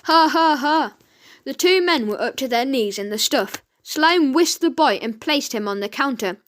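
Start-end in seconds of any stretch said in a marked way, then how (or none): 0.0-0.9 hearty acted laugh, louder; mocking ha ha. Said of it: "Ned's turn to do the chuckling".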